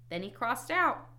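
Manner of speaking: ordinary delivery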